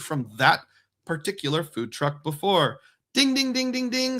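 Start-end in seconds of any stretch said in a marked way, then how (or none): 3.1-4.2 mock game-show chime. Game-show correct-answer chime.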